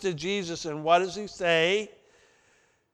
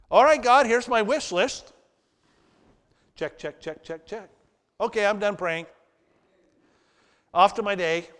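mock careless prayer; 2 semitones higher than usual. Mocking how we normally pray.

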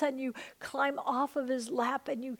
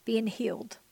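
ordinary talk

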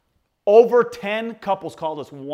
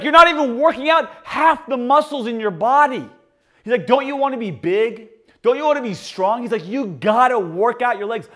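hammed-up coaxing; 7 semitones higher, 7 dB louder than usual. Roommate pleading.

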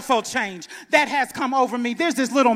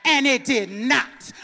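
normal talking voice

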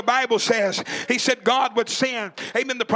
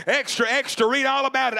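ordinary talk

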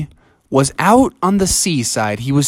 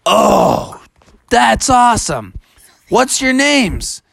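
higher-pitched character wonder; lightly voiced, amazed story hero; impressed Bubby; 7 semitones higher, slower than usual. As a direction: take it in with acted awe. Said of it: "And he said".

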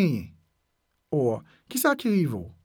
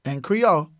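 ordinary talk

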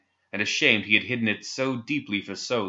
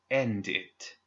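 growled; 5 dB quieter than usual. Acted. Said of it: "she growled".

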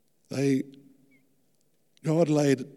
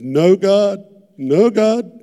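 loud, high pleading protest; crying out no; 10 semitones higher, 13 dB louder, slower than usual. Retold or imitated. Preacher retelling his refusal to God, loud high protest.

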